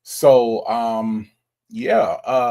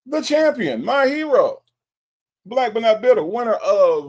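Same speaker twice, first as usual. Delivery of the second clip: much higher, hammed-up announcer hail; grand hail; 10 semitones higher, 5 dB louder than usual. Real, not acted. Hailing last night's trivia winner as a hammed-up champion. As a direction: trumpet the winner like a hero.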